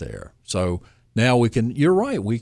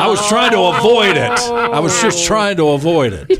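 laughing; 9 semitones higher, 6 dB louder than usual. Real, not acted. Joke about embers, laughing.

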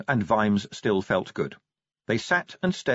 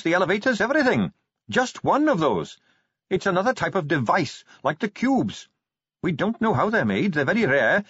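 lightly voiced eager Carrot; 8 semitones higher than usual. Carrot explains the device.

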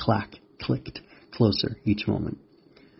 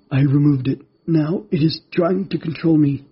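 high, loud, struggling spider character; distressed creature voice; 6 semitones higher, 5 dB louder than usual. Acted. Spider fighting the Vex taking control, high distressed voice. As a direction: push it out high and urgent.

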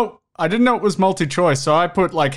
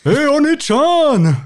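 anime voice; 8 semitones higher, much slower than usual. Acted. Anime parody voice.

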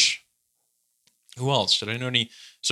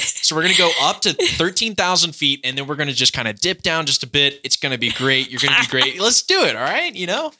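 Cali impression; 3 semitones higher than usual. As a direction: hype up a nervous student pilot, totally relaxed. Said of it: "that's my best Cali impression I can do".

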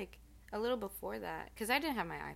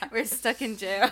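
laughing; 6 semitones higher, 20 dB louder than usual. Real, not acted. Joking, laughter heard.

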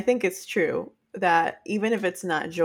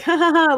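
mock laugh; 9 semitones higher, 7 dB louder than usual. Friend's laugh imitated.

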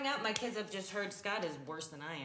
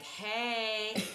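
mock kid taunt; 4 semitones higher, much slower than usual. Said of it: "the little guy, was like".

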